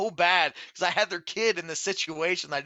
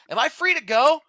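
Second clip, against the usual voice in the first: incredulous call out; 11 semitones higher, 9 dB louder than usual. Retold or imitated. Leaning out asking the cops.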